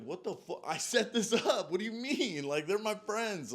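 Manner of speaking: incredulous outburst at the producers, laughing in shock; outraged disbelief. Retold or imitated. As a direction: blow up at them like you can't believe it. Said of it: "And I'm like".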